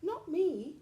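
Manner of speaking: high chirpy bird voice; high bird chirp. A narrator's reading. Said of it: "chirped the bird".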